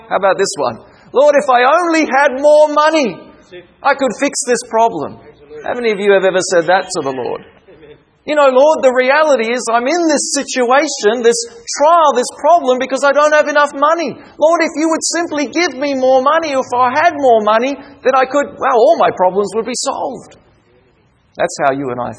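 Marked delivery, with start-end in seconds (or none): 1.1-5.2 high, louder mock prayer; frustrated plea. Acting a frustrated prayer for money. 8.2-20.4 high, insistent mock prayer; frustrated plea. Acting a frustrated prayer for money.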